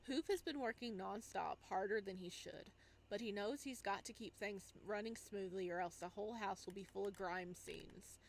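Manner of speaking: normal talking voice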